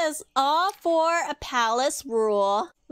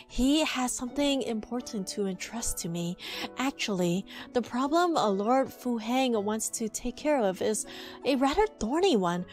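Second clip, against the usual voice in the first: reading game dialogue; 3 semitones lower, 3 dB quieter than usual. Acted. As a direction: softly read story lines. Reads game dialogue aloud.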